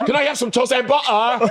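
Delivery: high, laughing mock British accent; mocking imitation. Teasing friend who sounded like him, mocking British imitation. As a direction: mimic the accent way up high, amused. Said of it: "you sound like him".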